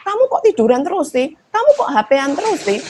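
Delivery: exasperated parent complaining; annoyed nagging. Retold or imitated. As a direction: scold the kid like a fed-up parent. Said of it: "Biasanya kan kita kalau kesel".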